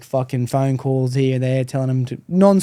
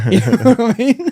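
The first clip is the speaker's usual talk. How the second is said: laughing; 9 semitones higher, 6 dB louder than usual. Real, not acted. Laughing at cult story.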